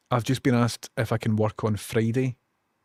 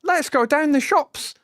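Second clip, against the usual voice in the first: high, louder imitation of an English cartoon voice; mock English accent; 5 dB louder than usual. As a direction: mimic the posh English Dennis. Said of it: "doesn't sound like he's for Dundee".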